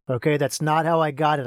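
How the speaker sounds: ordinary talk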